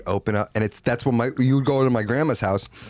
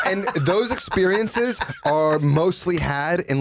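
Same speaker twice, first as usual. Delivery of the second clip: laughing through words; 7 semitones higher, slower than usual. Real, not acted. Laughing after big laugh.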